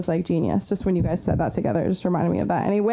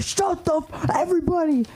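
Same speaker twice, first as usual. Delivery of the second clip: mock angry yell; 9 semitones higher than usual. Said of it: "They're like".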